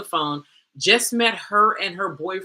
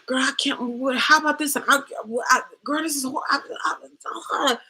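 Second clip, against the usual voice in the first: high-pitched, flustered stammering to a friend; mock drama; 8 semitones higher than usual. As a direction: play up the panicky girlfriend, tripping over words. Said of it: "all the drama".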